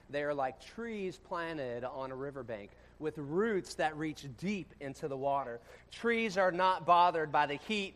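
loud, slow, high preaching; preaching scripture. Acted. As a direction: proclaim each line of the verse slowly and loudly. Reads scripture at full force.

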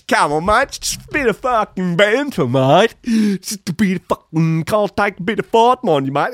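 high, hammed-up ocker bloke voice; mock Aussie bloke. Podcaster mocking the farting boss, hammed-up Aussie bloke. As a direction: lampoon the boss's banter excuse.

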